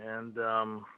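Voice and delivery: ordinary talk, nothing marked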